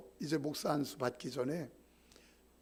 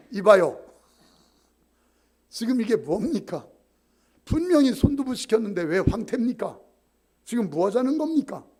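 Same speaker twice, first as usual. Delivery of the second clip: angry complaint; 5 semitones higher, 11 dB louder than usual. Pretend. Imagined angry complaint to staff.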